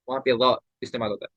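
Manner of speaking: ordinary talk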